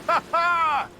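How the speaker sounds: high, hearty ha ha; delighted laugh. Acted. Merchant laughs as the ship picks up speed, delighted. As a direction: burst out laughing with joy.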